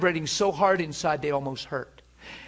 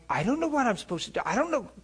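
desperate plea; 7 semitones higher, 3 dB quieter, faster than usual. Retold or imitated. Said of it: "it sounds desperate".

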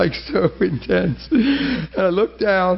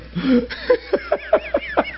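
high giggle. Real, not acted. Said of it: "I'm a mess".

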